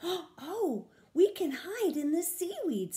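thrilled to find a hiding place; excited discovery. A narrator's reading. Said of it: "said Tammy".

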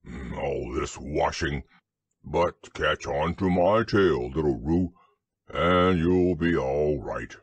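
low, slow donkey grumble; grumbling to oneself. A narrator's reading. Eeyore offers Roo a tail to catch, grumbling low. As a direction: drone it deep and unhurried. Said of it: "was grumbling quietly to himself".

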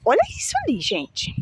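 high, delighted gasp of awe; gushing awe. Real, not acted. Gardener showing her followers the new flowers, gushing. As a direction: swoon over the blooms.

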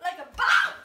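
loud mock-tough catchphrase yell; playful throat-straining holler; throaty shout. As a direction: punch out BOSS from the throat. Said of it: "It's gonna hurt your throat".

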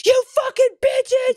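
high, slow, stretched-out yell; mock angry yell. Pranked guest bangs on the door and yells at the girls, mock furious. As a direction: play the furious pranked guy.

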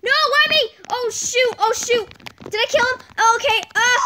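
usual speaking voice

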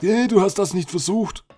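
a playful imitation of a complaining fan; mock viewer complaint. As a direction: tease the imagined critic. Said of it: "dass hinterher jemand sagt".

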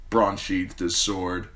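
ordinary delivery, nothing marked

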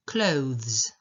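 "clothes" with full OH, then voiced th into z; clear th-z ending. Shown as a demonstration. Teacher showing a tricky word, "clothes", with careful th-z. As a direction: sound out the th and z in "clothes". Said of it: "To jest O, th, z".

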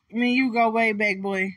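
ordinary talk

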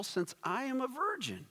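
very high-pitched, lightly mimicked questioner; Mary's question. A narrator's reading. Mary asking the angel, high-pitched puzzled question. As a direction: lift it right up high, puzzled. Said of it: "Mary asked the angel".

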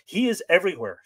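ordinary delivery, nothing marked

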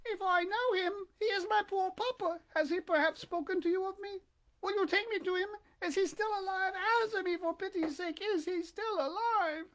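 very high puppet voice, anxious questions; pleading Pinocchio. A narrator's reading. Puppet asking the pigeon after poor papa, high pleading. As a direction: beg for news in a high little voice.

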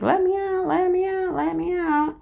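high pleading rooster voice, sung out. Retold or imitated. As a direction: beg like a rooster wanting out. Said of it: "he's like".

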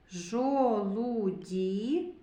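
"Жолуді" very slow, every syllable stretched; sound-by-sound. Shown as a demonstration. Teacher picks out the soft consonant, "Жолуді" very drawn out. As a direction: stretch "Жолуді" through to a long ді.

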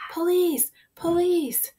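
high-pitched cry for help, easing off; storybook shout for the police; loud terrifying shout. A narrator's reading. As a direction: yell the alarm high and drawn out. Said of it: "shouted in his loudest and most terrifying voice".

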